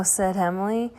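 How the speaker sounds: usual speaking voice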